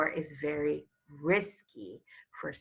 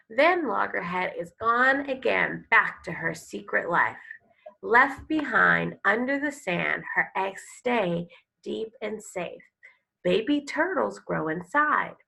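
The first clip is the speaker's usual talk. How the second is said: louder, expressive reading of a turtle picture book; storybook read-aloud; 6 dB louder than usual. Acted. Teacher reading a turtle book to her class, expressive storytelling. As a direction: read it like a nature story for kids.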